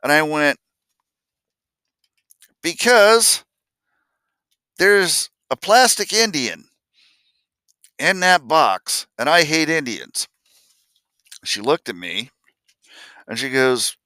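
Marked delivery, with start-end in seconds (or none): 2.6-10.3 put-on child's answer. Child answering mom, tearful.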